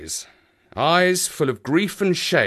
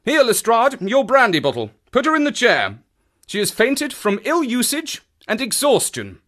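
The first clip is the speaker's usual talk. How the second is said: high-pitched, urgent orders; urgent detective; 11 semitones higher than usual. Acted. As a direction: take charge of helping the fainted woman. Holmes gives urgent orders.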